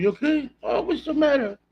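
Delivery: high, soft pet talk to a dog. Real, not acted. Talking to his dog, soft high baby voice.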